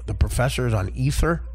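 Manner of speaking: normal talking voice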